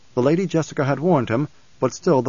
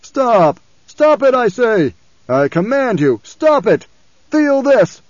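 put-on raging old autocrat, loud and high; Baron Harkonnen; 9 semitones higher, 7 dB louder, slower than usual. A narrator's reading. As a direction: shout desperate, imperious commands. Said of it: "voice of the old Baron raged".

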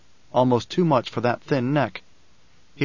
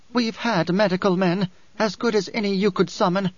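higher character voice, controlled bitterness; Dory, bitter woman; 6 semitones higher than usual. Acted. Dory speaks of the sick man's water, bitterly. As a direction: lift the voice for an embittered woman.

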